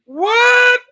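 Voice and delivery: high, loud, stunned outburst; a sudden shocked squawk of disbelief; shocked what. Retold or imitated. Disbelief at young Bolivian teacher.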